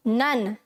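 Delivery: नन as short low "nun"; correct pronunciation. Shown as a demonstration. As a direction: drop low on नन, short vowel. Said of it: "नाउन नहीं आएगा".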